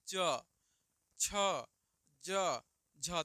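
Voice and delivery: च छ ज झ called out loud with tongue on palate; loud palatal demo. Shown as a demonstration. Teacher drilling palate sounds for students. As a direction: punch each sound out clearly. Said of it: "च बोलिए तो च भी आपका जीभ".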